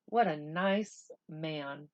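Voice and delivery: ordinary delivery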